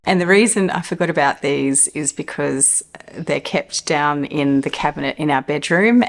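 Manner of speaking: normal talking voice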